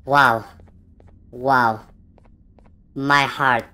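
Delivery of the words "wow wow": ordinary talk, nothing marked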